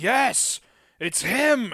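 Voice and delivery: put-on loud, high drunken insistence; high loud mock yell; insistent. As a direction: shout it like a drunk fan. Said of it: "you're like".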